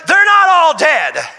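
loud, high, slow sermon cry; fiery preacher; preaching at full force. Acted. Preaching climax.

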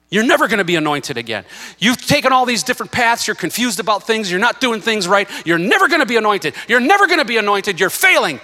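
loud, high-pitched taunting shout; devil screaming. Acted. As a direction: hurl accusations at full voice, acting the devil. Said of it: "the devil was literally screaming in my ear".

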